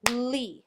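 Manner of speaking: lee alone, loud, with a held L; isolated syllable. Shown as a demonstration. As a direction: say lee as one emphatic beat, lllee. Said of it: "my second syllable".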